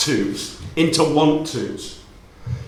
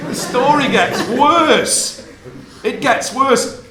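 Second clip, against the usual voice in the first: slow playful warning; 7 semitones higher, much slower than usual. Real, not acted.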